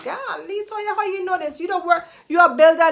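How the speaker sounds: high, surprised mock admirer; imitating an amazed listener in a high voice; amazed onlooker. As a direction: squeak it out like a dazzled fan. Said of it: "And you'd be like".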